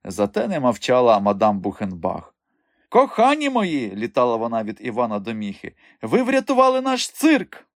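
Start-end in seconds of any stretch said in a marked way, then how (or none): 2.9-3.9 high, drawn-out gushing; effusive, high-pitched madam; gushing delight. A narrator's reading. Delighted madam thanks her rescuers. 6.0-7.7 very high, elated exclamation; effusive, high-pitched madam; gushing delight. A narrator's reading. Delighted madam thanks her rescuers.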